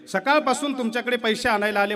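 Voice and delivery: a wife searching for her missing husband; anxious village wife's call; worried wife. Retold or imitated. Worried wife asks sethji.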